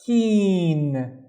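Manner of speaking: KEEN alone with a long ee; drawn-out isolated "keen"; syllable demo. Shown as a demonstration. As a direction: hold the vowel of "keen". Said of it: "this syllable starts with the kasra".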